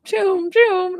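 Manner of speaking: high, loud imitated whooshes of a dashing dog; high "choom" zooming noises. Pet psychic imitating a dog racing off, high zooming noises. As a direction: zoom about with high-pitched bursts.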